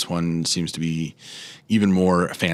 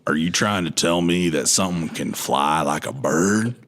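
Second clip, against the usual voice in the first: Arthur Morgan. Acted. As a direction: play a baffled Old West outlaw. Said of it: "I'm gonna do my Arthur Morgan voice again".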